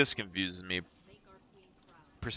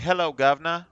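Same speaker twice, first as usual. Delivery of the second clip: higher and louder cockney-style Hello, governor; a hammed-up British greeting, pitched up; mock British accent; 6 semitones higher, 6 dB louder than usual. Kids teasing with a jokey British accent. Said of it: "people would used to do, like, the British accent".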